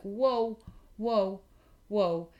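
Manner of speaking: alarmed whoa. Retold or imitated. Retelling her alarm as the vet injects the puppy. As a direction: stop him in alarm. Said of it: "and I was like".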